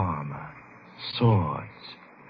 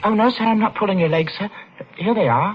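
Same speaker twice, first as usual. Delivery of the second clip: put-on higher, fast, timid boy; frightened boy offering coins to a brute; nervous boy; 11 semitones higher, faster than usual. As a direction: stammer it out scared and eager. Boy pleading with shopkeeper.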